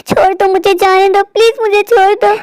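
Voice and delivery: high-pitched frantic plea; distressed pleading. Acted. Stepsister begs her attacker to let go, distressed pleading. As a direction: beg desperately to be released.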